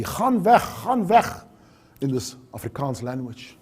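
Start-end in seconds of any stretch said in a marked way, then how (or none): 0.0-1.3 retold scream. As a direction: imitate a distraught wife's cry, held back. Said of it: "She just screamed at me".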